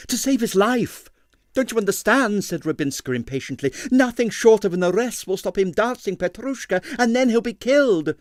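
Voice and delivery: high, urgent, desperate pleading; distressed dancer urging the inspector; pleading ballerina. A narrator's reading. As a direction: beg him to understand, voice up high.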